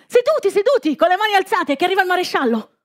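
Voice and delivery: high urgent shouted orders; rally everyone to sit down; urgent shouting. Acted.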